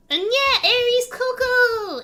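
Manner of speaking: high, loud cooing call to the snake; excited pet call. Real, not acted. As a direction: gush over the pet snake.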